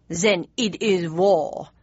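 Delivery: slow, solemn declaration of war; grave announcement. A narrator's reading. Bismarck announces war to Mum, slow and solemn. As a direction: pronounce it like a verdict. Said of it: "announced Bismarck".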